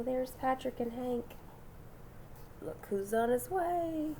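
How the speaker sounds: ordinary delivery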